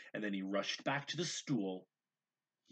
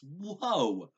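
high, loud amazed exclamation; be truly astonished; amazed whoa; 8 semitones higher, 9 dB louder than usual. A narrator's reading. Mother seeing the tidy toys, amazed exclamation.

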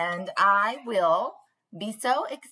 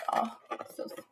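soft, hushed coo over a picture; tender aside; 8 dB quieter than usual. Real, not acted. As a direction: sigh it out fondly like admiring babies. Teacher cooing over book pictures.

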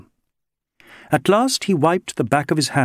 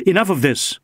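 higher-pitched, businesslike cut-off; brisk inspector taking back control; sudden briskness; 7 semitones higher than usual. A narrator's reading. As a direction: cut the talk short briskly. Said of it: "he said with sudden briskness".

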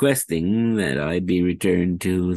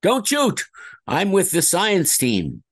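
panicked scientist; 12 semitones higher, 3 dB louder, slower than usual. Acted. Voice actor performing his game lines.

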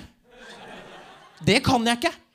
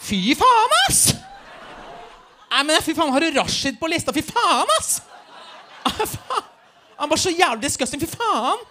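put-on disgusted teen girl; 9 semitones higher, slower than usual. Teen girl on the bus finding Rashid on the list, shrieking in disgust. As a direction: squeal in teenage revulsion.

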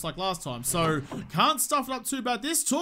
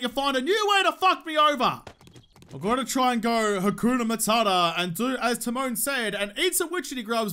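usual speaking voice

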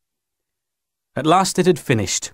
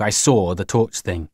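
usual speaking voice

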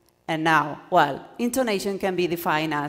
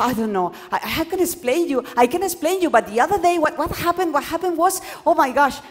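flustered, stumbling start, higher and louder; flustered rambling; 9 semitones higher, 8 dB louder, faster than usual. Shown as a demonstration. Teacher acting a confused speaker who loses the class. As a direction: babble nervously with no point. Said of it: "what I'm doing now?".

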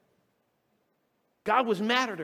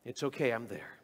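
softer and lower, calm reassurance; gentle God; 5 semitones lower, 4 dB quieter than usual. Retold or imitated. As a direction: settle a nervous heart quietly. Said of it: "He says".

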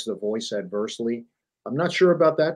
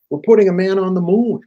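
higher and louder, proud janitor's reply; proud janitor answering the president, higher and loud; 8 semitones higher, 8 dB louder than usual. Retold or imitated.

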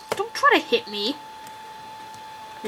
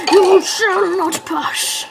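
wizard voice; 6 semitones higher, 3 dB louder, much slower than usual. Pretend. Gandalf-style quote repeated.

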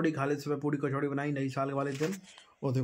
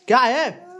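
loud, higher impatient "what is it"; loud question; 9 semitones higher, 11 dB louder, much slower than usual. Real, not acted. Father asks sulking son what's wrong, loud and impatient.

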